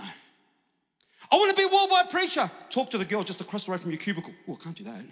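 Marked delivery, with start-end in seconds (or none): 0.0-2.5 very high, loud, drawn-out proclamation; hammed-up dreamer stretching out the big wish; mock grand ambition. Acts out grand wish before deflating. 4.5-5.0 mock reluctance. Acts out timid refusal after boast.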